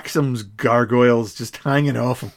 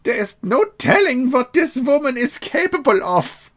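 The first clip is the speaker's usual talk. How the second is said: put-on high-pitched German-accented alarm; 11 semitones higher than usual. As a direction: fret in a stagey German accent. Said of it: "that German accent".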